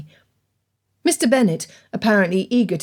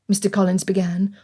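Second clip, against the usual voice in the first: ordinary talk, nothing marked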